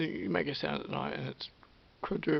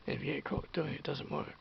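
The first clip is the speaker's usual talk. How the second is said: ordinary delivery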